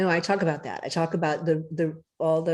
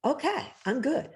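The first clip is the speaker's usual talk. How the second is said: satisfied eater; 7 semitones higher, 3 dB quieter than usual. Retold or imitated. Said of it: "you'll go".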